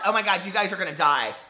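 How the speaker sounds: ordinary delivery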